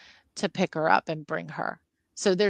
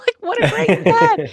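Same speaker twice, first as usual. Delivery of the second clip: laughing delight; 7 dB louder than usual. Real, not acted. Laughing at the story.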